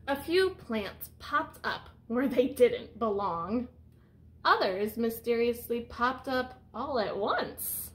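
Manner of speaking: picture-book read-aloud voice; reading to children. Acted. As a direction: read it out to the class like a storyteller. Teacher reads picture book aloud.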